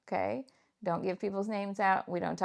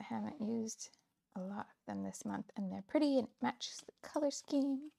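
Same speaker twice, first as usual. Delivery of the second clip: whisper; 3 semitones higher, 9 dB quieter than usual. Real, not acted. Whispered crafting aside.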